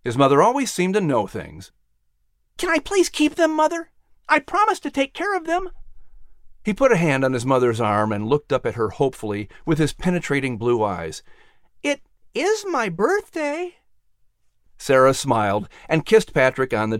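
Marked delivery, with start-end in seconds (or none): 2.6-5.7 put-on high, eager little boy pleading; a hopeful child asking his mother; boy begging to keep his pets; young boy voice. 11.8-13.8 put-on high, eager little boy pleading; a hopeful child asking his mother; boy begging to keep his pets; young boy voice. Patrick adds his birthday plea.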